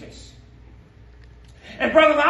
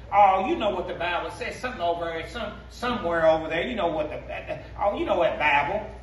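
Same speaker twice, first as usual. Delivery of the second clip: mocking vague churchgoer; 7 semitones lower than usual. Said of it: "they will talk about it".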